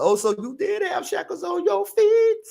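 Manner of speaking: mock surprise. Mocking aside to the deportees.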